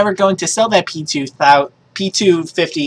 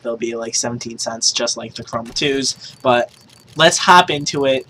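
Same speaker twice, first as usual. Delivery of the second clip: normal talking voice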